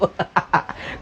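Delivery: breathy chuckle; tawa. Real, not acted. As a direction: laugh after teasing. Laughing at own joke. (tertawa kecil)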